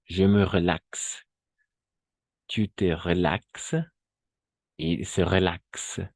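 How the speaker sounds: each French form drawn out slowly; slow classroom demo of "relaxe" forms; slow model conjugation. Shown as a demonstration. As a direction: read the forms slowly for students to copy. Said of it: "Las vamos a poner así".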